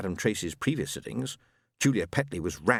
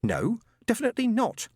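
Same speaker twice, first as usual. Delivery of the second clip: testy; 13 semitones higher than usual. A narrator's reading. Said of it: "was rather testy".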